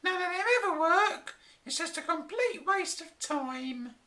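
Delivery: high, complaining voice; moaning wife. A narrator's reading. Flora complaining about the mad professor, high moaning voice. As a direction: moan at him, high and fed up. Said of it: "his angry wife".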